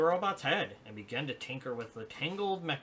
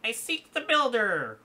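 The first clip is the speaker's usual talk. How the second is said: high, slow, loud robot drone; 10 semitones higher, 9 dB louder, slower than usual. Acted. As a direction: repeat it like a glitching robot. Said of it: "repeats the robot".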